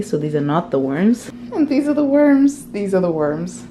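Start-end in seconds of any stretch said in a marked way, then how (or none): 1.5-2.7 high, loud sing-out; playful reveal. Pretend. Vlogger unboxing the worms, high and loud playful reveal. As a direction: present them with a raised, excited voice.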